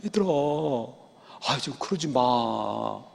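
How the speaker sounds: gentle, slow, soft plea to the sons; hushed fatherly pleading. Retold or imitated. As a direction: coax them softly like a weak father. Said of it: "다시 말한다면".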